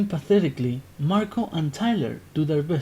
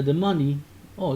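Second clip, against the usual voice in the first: normal talking voice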